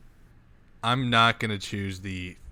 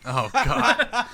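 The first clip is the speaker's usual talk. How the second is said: laughing; 10 semitones higher than usual. Real, not acted. Groans at gross scenario, laughing.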